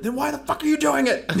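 high, loud laughing bark; drill sergeant yell. Retold or imitated. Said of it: "No, drill sergeant".

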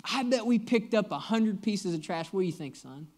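breezy, upbeat retelling of Dad's words; cheerful dad. Retold or imitated. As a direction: chirp it like a pleased father. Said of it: "very cheerfully, he's just like".

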